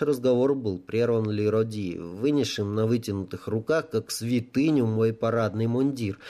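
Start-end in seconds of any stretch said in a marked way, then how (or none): none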